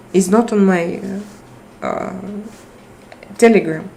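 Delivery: ordinary delivery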